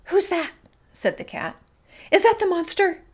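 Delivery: scared. A narrator's reading. Scared cat hiding under bush.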